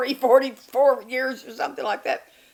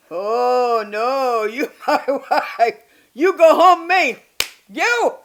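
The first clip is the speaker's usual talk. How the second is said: loud mock Filipino accent; 4 semitones higher, 10 dB louder, much slower than usual. Husband's reply acted louder.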